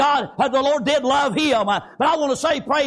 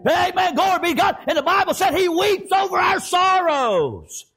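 high, ringing sermon cry; fervent preaching; 5 semitones higher than usual. Acted. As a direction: lift it high and preach with full force. Preaches at full force.

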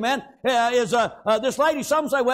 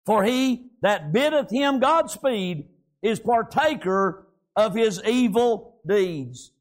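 scripture reading; 4 semitones lower than usual. Acted. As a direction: read the verses like old King James text. Reads scripture aloud before preaching.

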